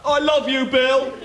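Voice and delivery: gushing host. Acted. Host gushes at Lou on leaving. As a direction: coo it like a smitten fan.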